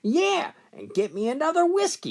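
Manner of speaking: high, fast squawking bird voice; lightly voiced rude parrot squawking; mock parrot squawk at the stewardess.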